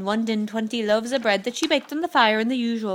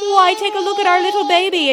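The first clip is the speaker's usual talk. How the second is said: high, loud proud-mother patter; 10 semitones higher, 8 dB louder than usual. A narrator's reading. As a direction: show off the baby brightly. Wife shows off the baby.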